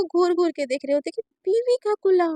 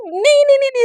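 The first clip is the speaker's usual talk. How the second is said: high, louder, frantic no; panicked refusal; 9 semitones higher, 9 dB louder than usual. Acted. Naina begging father not to call her wife, panicked. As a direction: stop them in a panic.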